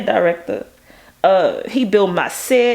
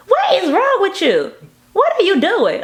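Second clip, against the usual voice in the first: high, alarmed mom voice, imitated; mom's shocked voice; 11 semitones higher than usual. Son imitates his mother asking what's wrong with him, high and alarmed. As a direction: play his mother, startled and scolding. Mom reacting to the blood request.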